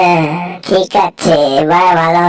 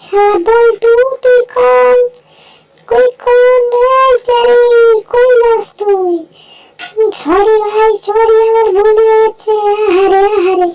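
squeaky cartoon character; 13 semitones higher than usual. Beaten-up sidekick complaining to Jerry, squeaky high voice. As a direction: pitch up into a shrill little cartoon voice.

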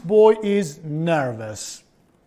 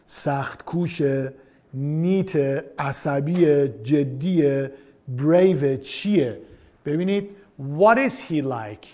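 ordinary delivery

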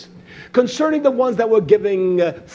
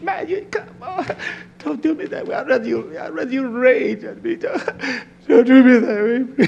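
laughing; 5 semitones higher than usual. Real, not acted. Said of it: "laugh cry".

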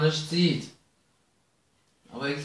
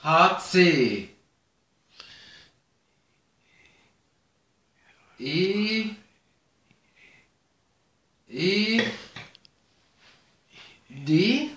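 slow, halting letter-by-letter; long pauses between letters; backwards spelling; 2 semitones higher, much slower than usual. Real, not acted. (zögerlich rückwärts buchstabieren)

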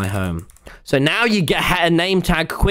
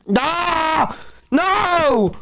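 higher, yelping no; real dismay; 7 semitones higher than usual. Real, not acted. Yelping in dismay as his pet spider runs off. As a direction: cry out as the pet escapes.

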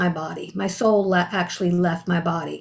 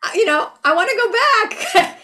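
mock crying; 9 semitones higher than usual. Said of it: "I was crying and crying".

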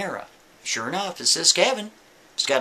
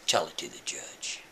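much lower, quiet flat dismissal; low cold cop; 11 semitones lower, 10 dB quieter than usual. Retold or imitated. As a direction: shut him down coldly. Cop's cold reply again.

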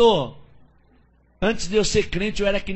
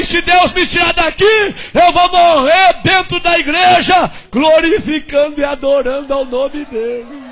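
fervent shout; 8 semitones higher, 6 dB louder than usual. Retold or imitated. Prisoner's vow, shouting.

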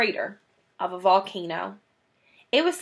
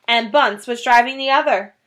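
plain reading voice

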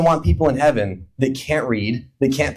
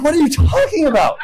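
bewildered; 10 semitones higher than usual. Retold or imitated. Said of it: "you're like".

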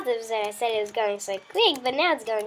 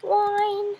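mock whine; 8 semitones higher than usual. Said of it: "He's whining".

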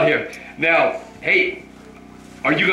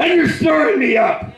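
high, shouted renunciation, much higher; shouted prayer; 14 semitones higher than usual. Real, not acted. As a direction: cast it out at the top of the voice.

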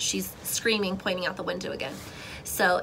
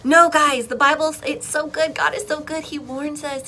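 ordinary delivery

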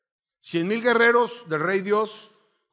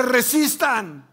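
slow, drawn-out order, higher and a bit louder; commander's order; 5 semitones higher, 4 dB louder, much slower than usual. Acted. Spartan leader to his soldiers, slow drawn commanding call. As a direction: hold the line, stretch every syllable.

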